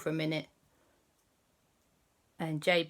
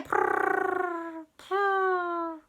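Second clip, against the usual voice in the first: cat purr; 11 semitones higher than usual. Acted. Cat witch purrs.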